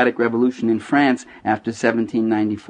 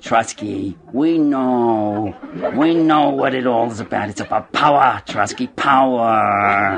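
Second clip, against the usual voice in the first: slow, ponderous movie-communist voice; drawn-out words, solemn mock revolutionary gravity; Lenin; 3 semitones lower, slower than usual. Lenin addressing Trotsky in a film, ponderous parody. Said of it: "Lenin turns to Trotsky and he says".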